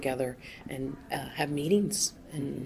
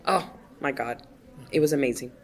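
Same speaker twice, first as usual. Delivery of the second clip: ordinary talk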